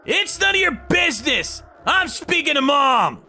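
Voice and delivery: high-pitched acted retort; angry brother. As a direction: shut your sibling out sharply. Brother snaps at intruding sibling.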